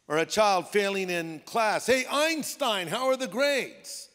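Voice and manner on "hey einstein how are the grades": high, slow teasing call; mocking. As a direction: needle them with a drawn-out greeting. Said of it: "Almost like mockery".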